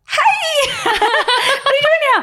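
hammed-up high-pitched friendliness; overbright greeting. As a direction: greet in forced squealing cheer, laughing. Retold fake cheery greeting.

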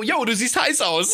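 easygoing, upbeat praise; casual compliment. Acted. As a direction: toss it out lightly and friendly. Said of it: "Sondern einfach so".

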